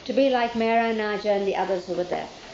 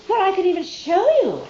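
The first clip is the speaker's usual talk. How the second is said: put-on eager child; 9 semitones higher, 6 dB louder than usual. Child eagerly offers to show Baba.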